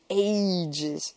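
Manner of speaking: long-suffering sigh on the word; weary, sighing complaint. A narrator's reading. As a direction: let it out as a drawn sigh. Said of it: "sighed Monica".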